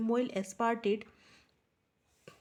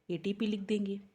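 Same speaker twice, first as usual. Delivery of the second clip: ordinary talk, nothing marked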